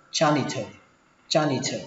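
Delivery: "janitor" pronounced ja-ni-thơ, T with a puff; aspirated T. Shown as a demonstration. Teacher shows third reading, "janitor" ending in aspirated "thơ". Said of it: "các bạn đọc thành âm thờ".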